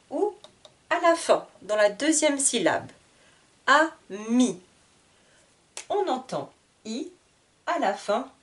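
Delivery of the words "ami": "Ami" as two separate beats, A... mi; split into syllables. Shown as a demonstration. Teacher repeating for the class, "Ami" split A... mi. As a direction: pause between the syllables of "Ami". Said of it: "le mot est coupé en deux".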